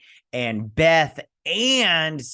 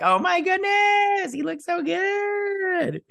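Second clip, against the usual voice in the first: very high, slow, shouting delight; squealing fan gush; 4 dB louder, slower than usual. Real, not acted. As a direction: squeal like a fangirl who is close to tears. Gushing over villain's look.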